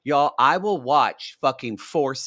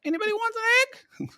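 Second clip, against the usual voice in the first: much higher, sing-song, giggly put-on voice; Jenny's silly voice; slower than usual. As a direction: play it dotty and lilting. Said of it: "Jenny just sits there and goes".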